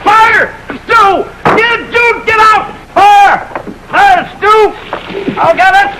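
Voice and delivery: frantic high yells of alarm; panicked shouting. Acted. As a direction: scream for help, the house is burning. Fire breaks out, yelling alarm.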